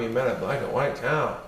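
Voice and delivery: ordinary delivery, nothing marked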